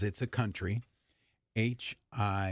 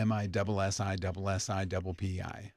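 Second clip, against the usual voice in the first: rattled off quickly; fast rhyming spelling; 3 semitones lower, much faster than usual. Shown as a demonstration. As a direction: rush through the letters. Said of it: "I always learned it".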